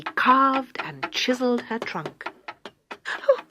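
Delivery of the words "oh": sobbed. Acted. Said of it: "she sobbed".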